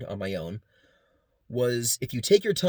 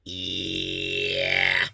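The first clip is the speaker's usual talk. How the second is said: vocal cords and false folds buzzing an octave apart; doubled robot-like drone; two-octave voice; 5 semitones lower than usual. Shown as a demonstration. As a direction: growl a low undertone under the note. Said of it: "It sounds like this".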